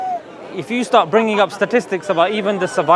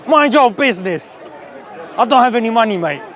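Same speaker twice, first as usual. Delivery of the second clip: rude Londoner brush-off; 3 semitones higher than usual. Retold or imitated. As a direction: wave off a stranger like a busy Londoner. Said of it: "Here in London".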